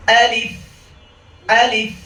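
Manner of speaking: high, firm "আলিফ" with a held f; loud throaty onset on "আলিফ", long f; correct alif. Shown as a demonstration. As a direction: say "আলিফ" crisply, stretch the final f. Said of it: "ফাই ধরে রাখতে হবে".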